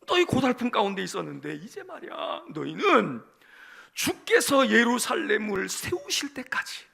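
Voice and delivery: loud, commanding proclamation; prophet addressing people. Acted. Isaiah speaking to his weary people, loud and commanding. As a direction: call out as a prophet, stretching the final phrase. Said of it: "이사야가 백성들에게 이렇게 얘기해요".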